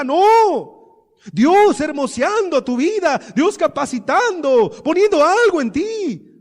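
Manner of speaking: loud volley of challenging questions, climbing in pitch; full-force preaching. Real, not acted. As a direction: build to a high pulpit shout.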